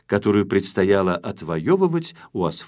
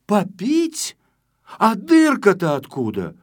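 incredulous questioning; 9 semitones higher, 4 dB louder than usual. Acted. Shpuntik pressing with questions.

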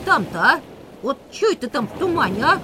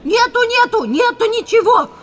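panicked denial; 9 semitones higher, 5 dB louder than usual. Acted.

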